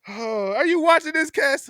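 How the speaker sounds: high, loud teasing sing-song; a friend teasing the Raiders fan on the phone; mock-innocent friend. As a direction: tease him innocently. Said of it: "I said".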